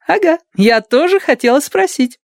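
very high, eager chiming in; eager young nurse. Acted. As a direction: chime in eagerly. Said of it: "поддакнула Адати".

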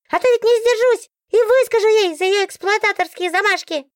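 very high, outraged book voice; the book bristles with outrage; indignant book. A narrator's reading. Book demands hiding place. (возмущённо, очень высоким голосом книги)

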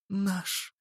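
put-on high, soft, quavering girl's voice; trembling whisper. A narrator's reading. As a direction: whisper it with a shaking voice. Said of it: "дрожащим голосом прошептала Анджела".

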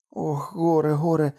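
exclaiming. A narrator's reading. Kokua laments to her husband, exclaiming. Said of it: "вигукнула Кокуа".

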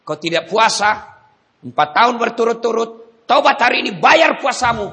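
forceful sermon; fiery preaching. Acted. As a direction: preach it loud and high.

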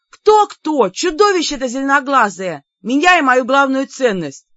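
high, loud exasperated answer; loud, high indignation; indignant shouting. A narrator's reading. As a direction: yell the accusation back to the next room. Героиня возмущённо кричит брату.

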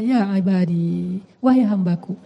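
hushed, loving summons to a servant; tender call. Acted. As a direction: call gently, lingering on the vowels. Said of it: "panggilan lembut dari Allah kepada makhluk".

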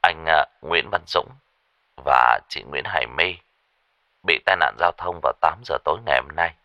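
low, subdued, composed delivery; forced calm. Acted. Caller tells a father of his children's fatal accident, carefully calm. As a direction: break the news gently, keeping composure, with small hesitations. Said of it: "vẫn cố giữ vẻ bình tĩnh".

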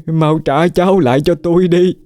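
a lament rising to a high cry; grieving plea. Acted. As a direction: beg for the grandchild back, heartbroken. Grandmother wails for dead grandson.

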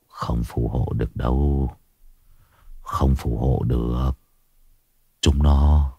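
Vietnamese: giọng ông già bị ma ám, trầm và rất nhỏ; haunted old man. Acted. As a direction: murmur it like a man already half dead. Haunted old man's ghostly lines.

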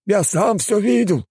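high, drawn-out exclamation; eager exclamation. A narrator's reading. Homeless witness backs the driver, high exclamation. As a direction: pipe up slowly at high pitch. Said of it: "воскликнул бездомный".

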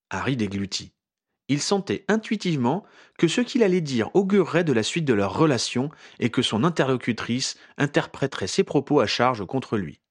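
plain reading voice

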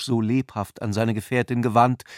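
ordinary delivery

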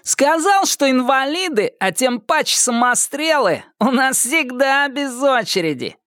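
издевательский высокий голос задиры; громко паясничать на публику; театрально громко. A narrator's reading. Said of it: "Громко, как в театре". (театрально и насмешливо)

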